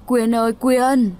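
slow, stretched call across the yard; a slow, searching call. A narrator's reading. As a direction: call the daughter's name, slowly. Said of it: "vừa đi vừa cất tiếng gọi".

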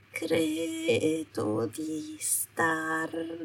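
very slow, high, faint slurring as she fades; fading slurred mumble. Acted. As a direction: drag the words out and lose them. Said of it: "Le parole le uscirono biascicate".

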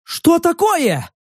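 high, drawn-out startled question; startled alarm. A narrator's reading. One of the men jumps back from the mud, startled. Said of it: "испуганно отпрыгнув от булькающей грязи".

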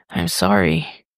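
shaky, barely whispered apology; trembling whisper. A narrator's reading. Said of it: "Tim whispers, shakily".

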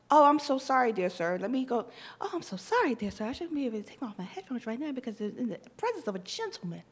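simpering mock apology to a gentleman; a hint of a prim transatlantic lady; mock deferential lady. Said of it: "get me on his side of being like".

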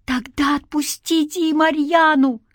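high, pressing demand; urgent plea. A narrator's reading. Man bargaining for a captive girl, urgent plea. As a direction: raise the pitch and plead for release.

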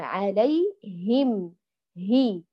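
"عليهم" very slow with clear him, then "هي"; stretched "عليهم", crisp hi on "هي"; haa with kasra. Shown as a demonstration. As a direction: isolate the i after h in "عليهم هي". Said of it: "هنا الهاء مكسورة".

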